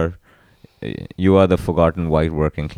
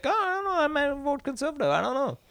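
high falsetto; 9 dB quieter, faster than usual. Retold or imitated. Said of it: "I was like".

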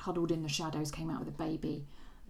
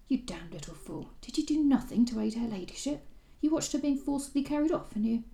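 angry rebuke; 8 semitones higher, 5 dB louder than usual. Acted. Viscount scolds the tiger.

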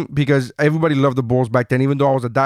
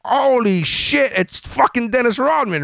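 awestruck; 6 semitones higher, slower than usual. Retold or imitated. I was fucking in awe.

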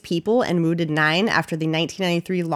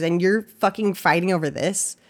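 normal talking voice

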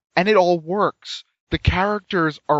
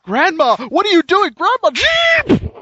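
panicked passenger; 13 semitones higher, 5 dB louder than usual. Acted. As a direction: squeal in terror at grandma. Acting panicked car passenger.

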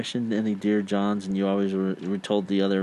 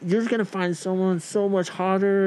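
mock consoling; 9 semitones higher than usual. Friends consoling dumped missionary.

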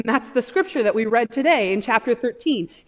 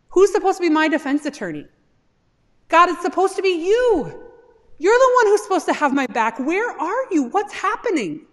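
higher, louder, fast and urgent; desperate demand; 7 semitones higher, 4 dB louder than usual. Acted. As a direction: demand an answer from an absent God. Job demanding his defender God.

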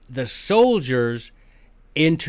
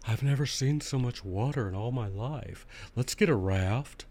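mock Arizonan; 2 semitones lower, 8 dB quieter than usual. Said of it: "y'all be like".

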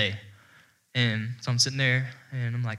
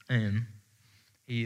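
usual speaking voice

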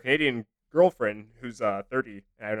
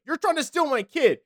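shrill accusing voice; 12 semitones higher than usual. Pretend. Voicing an accusing stranger.

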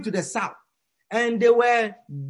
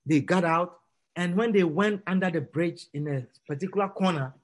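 normal talking voice

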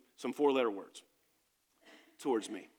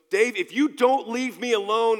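louder and higher, a threatening yell; retold angry threat; 5 semitones higher, 6 dB louder than usual. Friend yelling a threat at Dave, angry and louder. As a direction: make the threat hot and loud.

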